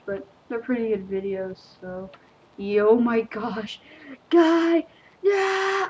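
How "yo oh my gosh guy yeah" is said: high, loud burst of excitement; excited outburst. Real, not acted. Player reacting to the game, excited outburst. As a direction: exclaim it loud, pitch leaping up.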